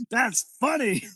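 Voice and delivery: high-pitched, self-amused boss impression; Vince McMahon. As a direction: crack yourself up as the boss. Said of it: "He s-, said".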